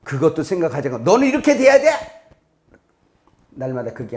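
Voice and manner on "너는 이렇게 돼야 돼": sharp, louder and higher demand; imitating a pushy parent. Parent shouting a demand at a child, loud and high. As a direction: bark it like a controlling parent.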